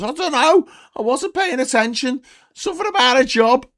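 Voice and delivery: very high, loud Scouser shout; high indignant acted outburst; worked-up punchline. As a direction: yell it back still fuming.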